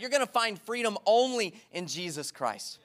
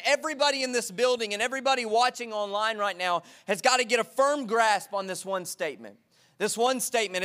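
ordinary talk, nothing marked

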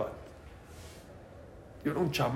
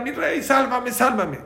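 crying for help; 8 semitones higher than usual. Retold or imitated. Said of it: "Y empezó a gritar".

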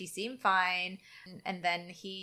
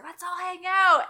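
high, louder eager call; mock eager invite; 10 semitones higher, 7 dB louder than usual. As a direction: sell it, high and bright.

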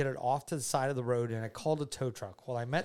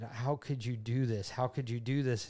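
ordinary delivery

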